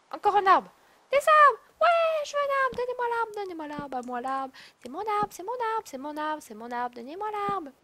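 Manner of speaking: high, greedy chant for the tree; greedy excited squeal. Acted. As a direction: whine like a greedy kid. Réclame un arbre, voix aiguë. (voix aiguë et gourmande)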